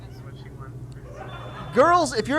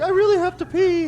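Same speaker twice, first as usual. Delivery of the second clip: put-on high, whiny little-girl plea, a touch teary; crying little girl; 10 semitones higher than usual. Dad mimics his crying daughter begging to pee. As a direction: squeak it up like a desperate little kid. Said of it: "And she starts crying".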